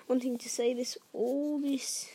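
usual speaking voice

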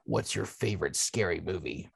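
low, soft creepy caller voice; Ghostface voice. Acted. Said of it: "in my best ghost vo-, Ghostface voice".

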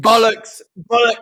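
loud, high mock-British exclamation; British accent. Co-host echoes British slang, loud mock accent. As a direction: belt it out in a put-on British accent. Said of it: "we gotta talk with a British accent now".

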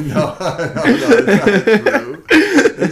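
protesting through loud laughter; laughing. Real, not acted. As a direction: laugh it off, slow and high. Laughing off teasing.

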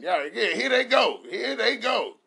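high, loud celebrating. Retold or imitated. Dad celebrating after the kids are taken, high and loud. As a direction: cheer like a win.

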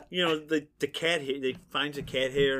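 normal talking voice

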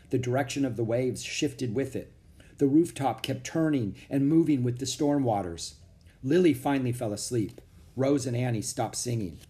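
ordinary delivery, nothing marked

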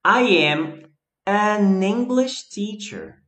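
slow, with weight on an; teacher slows down to show the article; careful demo. Shown as a demonstration. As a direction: model "an English" slowly for students. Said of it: "observe bem".

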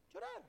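high, loud wailing cry on one word; a hammed-up mourner's wail; mock wail. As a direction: imitate the mourners' wail, briefly. Preacher answers, mock wailing.

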